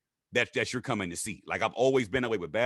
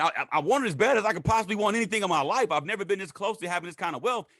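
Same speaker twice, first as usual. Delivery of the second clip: higher, pressing inner voice; a touch of hungry excitement; eager inner voice; 9 semitones higher than usual. Retold or imitated. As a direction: ask it eagerly. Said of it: "I'm thinking".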